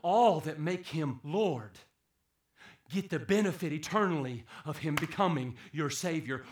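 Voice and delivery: usual speaking voice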